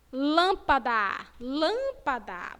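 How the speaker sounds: lâmpada slow, closed nasal â. Shown as a demonstration. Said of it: "Vocês pronunciaram com o som fechado". (lâmpada devagar, â fechado)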